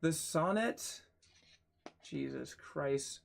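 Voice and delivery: ordinary talk, nothing marked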